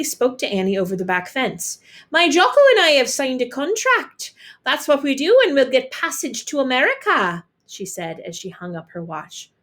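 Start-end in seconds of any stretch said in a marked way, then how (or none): 2.1-7.4 higher, louder chatty neighbour; high, loud neighbour woman. A narrator's reading. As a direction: brag over the back fence. Neighbour voiced.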